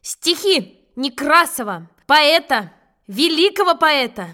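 slow, loud pompous title announcement; loud announcing. Acted. Boy grandly announces the poem to the teacher, slow and loud. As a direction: boom out the title slowly like a herald. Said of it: "И громко начал".

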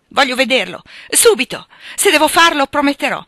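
loud, high, insistent outburst; urgent, agitated shouting; frantic demand. Acted. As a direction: demand it at once, beside yourself. Father demands to see his son.

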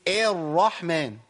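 slow, stretched الرحمن with the opening "a" thinned to "eh"; over-thinned vowel. Retold or imitated. Teacher imitating readers who overdo tarqiq, over-thinned الرحمن. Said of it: "هو عايز يرقق، فيبالغ قوي".

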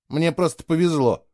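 mildly irritated. A narrator's reading. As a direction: answer with a touch of irritation. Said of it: "раздражённо ответил Фло". (слегка раздражённо)

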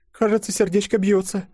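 put-on very high, girlish prattle; high little voice, tentatively hopeful; babbling timidly. A narrator's reading. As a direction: prattle it in a tiny squeaky voice. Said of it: "пролепетала она".

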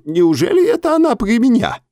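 put-on very high, startled old man voice; bewildered old man. A narrator's reading. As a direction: squeak out the disbelief. Naum's voice held. (высоким испуганным голосом)

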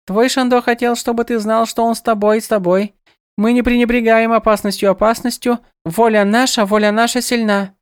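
high, earnest birdlike goddess; grave but lilting, repeating phrases; serious goddess voice. A narrator's reading.

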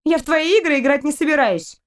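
indignant. Acted. Girl still indignant at captain.

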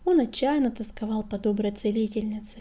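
ordinary delivery

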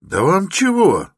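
very high, loud demand; shout, patience running out; angry shout. A narrator's reading. Porter growing seriously cross with stranger, angry shout. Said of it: "крикнул опять дворник".

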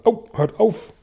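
high yelp of pain; pained cry. A narrator's reading. Centipede hit on the nose, high yelp of pain. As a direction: yelp like you've been hit. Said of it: "schrie Tausendfüßler".

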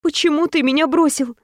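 put-on a wounded girlfriend repeating her question; heroine asking again.

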